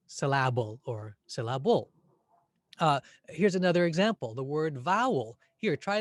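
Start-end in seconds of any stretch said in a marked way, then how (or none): 0.1-0.8 "syllable" wrongly as suh-LAB-ul; second-syllable stress. Shown as a demonstration. Teacher showing what the word is not, suh-LAB-ul. 1.3-1.9 "syllable" wrongly as sil-la-BULL; third-syllable stress. Shown as a demonstration. Teacher showing what the word is not, sil-la-BULL.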